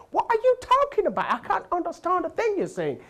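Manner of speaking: very high, exasperated clerk voice; baffled Englishman. Retold or imitated. Clerk baffled by the Nigerian guest's spelling, very high confused voice. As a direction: squeak out the confusion.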